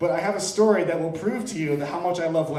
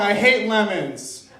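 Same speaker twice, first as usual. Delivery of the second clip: mock anger; 6 semitones higher, 8 dB louder, slower than usual. Mock outrage at crowd.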